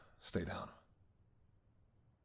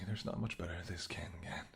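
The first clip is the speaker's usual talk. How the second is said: whisper; 4 semitones lower, 8 dB quieter than usual. Acted. Feeding on hunter.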